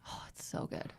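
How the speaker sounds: breathless whisper of delight. Real, not acted. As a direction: hush it like a guilty secret. Gushing about a TV show.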